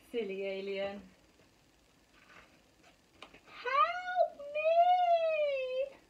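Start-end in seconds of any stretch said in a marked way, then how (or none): 3.5-6.0 high, loud cry for help, stuck in a tree; yelling for help. Acted. Alien stuck in tree calls for help, high yell. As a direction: shout it up high, desperate. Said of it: "Alien kept yelling".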